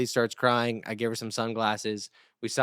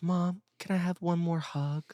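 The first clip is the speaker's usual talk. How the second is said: put-on higher, sweet little boy voice; tender kid impression, pitched up; Riley; 6 semitones higher, 4 dB quieter than usual. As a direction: imitate a child asking mom gently. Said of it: "And he goes".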